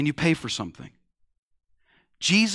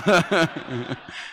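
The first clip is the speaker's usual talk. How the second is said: a quick real laugh at his own punchline; chuckle, then move on; short laugh. Real, not acted. Speaker laughs at own joke.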